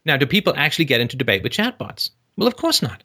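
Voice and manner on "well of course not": high, sarcastic of-course-not; squeaky sarcastic dismissal; mock obvious. Real, not acted. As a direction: answer as if it's blindingly obvious. Answering his own rhetorical question.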